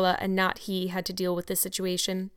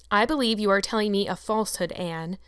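ordinary delivery, nothing marked

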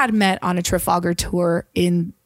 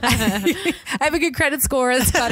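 laughing self-mockery; 5 semitones higher than usual. Real, not acted. Self-mocking aside while laughing.

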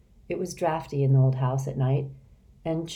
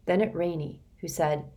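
ordinary delivery

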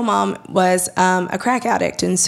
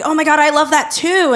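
eager chime-in; 6 semitones higher, 4 dB louder than usual. Retold or imitated. Butting into strangers' conversation.

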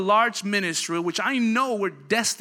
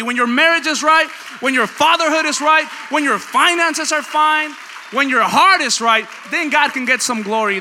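loud, high, driving sermon delivery; full-force preaching; 5 semitones higher, 10 dB louder than usual. Real, not acted. Preacher to church about God's glory, full-force preaching. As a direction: preach it hard, hammering each phrase.

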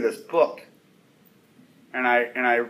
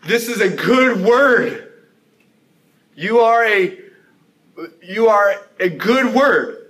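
louder, higher, slow proclamation; loud cry; 5 semitones higher, 6 dB louder, slower than usual. Acted. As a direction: cry it out slowly, with joy. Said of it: "exclaims with a loud cry".

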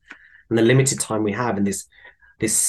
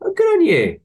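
much higher, bright mock-cheerful burst; cheery reply; 5 dB louder than usual. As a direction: overdo the cheer to needle her. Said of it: "I go".

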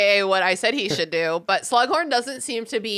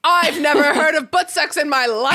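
indignant denial; 6 semitones higher, 5 dB louder than usual. Retold or imitated. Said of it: "And he's like".

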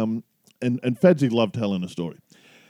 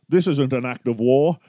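dry Connery insult, lightly Scottish; Sean Connery impression. His best quote for the co-host, in a light Scottish Connery voice. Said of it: "this is his best one".

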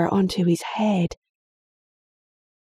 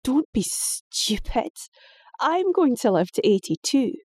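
put-on dismissive girl, much higher; scornful; 6 semitones higher than usual. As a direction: scoff at his fear. Daughter voiced, scornful.

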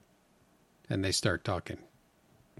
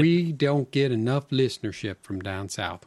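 put-on Southern accent. Podcast host putting on a light mock Southern accent. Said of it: "we should explain why we're talking like this".

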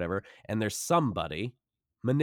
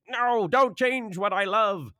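higher, louder, slow plea; whiny fan; 12 semitones higher, 6 dB louder, slower than usual. Retold or imitated. Guest mocking fans who fear change. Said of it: "we fear change".

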